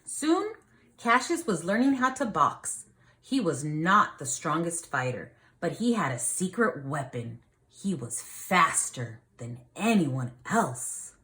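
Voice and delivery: lively storybook reading for a class; reading to children. A narrator's reading. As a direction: read it like a teacher reading a picture book to the class. Reads picture book aloud.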